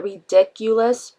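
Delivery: RIDICULOUS stretched out slowly, syllable by syllable; emphasized word. Real, not acted. Vlogger asking viewers about a reality show, RIDICULOUS drawn out for emphasis. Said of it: "I'm emphasizing a word in there".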